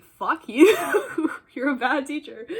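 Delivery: loud, high burst breaking into laughter; retold rant, played for laughs; mock chewing-out. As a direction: jokingly tell someone off, laughing midway. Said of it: "bitched her out".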